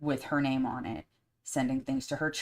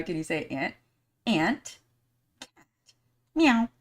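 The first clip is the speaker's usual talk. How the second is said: put-on teaching voice to a toddler; 4 semitones higher, 5 dB louder than usual.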